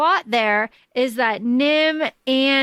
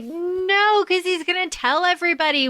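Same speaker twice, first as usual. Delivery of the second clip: urgent protest; 8 semitones higher than usual. Acted. Reader imagining herself in the story, urgent protest. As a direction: act out an alarmed objection.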